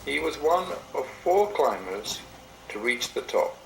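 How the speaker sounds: ordinary delivery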